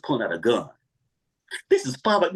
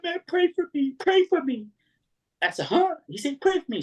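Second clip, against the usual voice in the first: high pleading voice; imitating a nervous stranger begging; pleading man. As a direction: beg him for prayer, high and urgent. Voicing the shaking man.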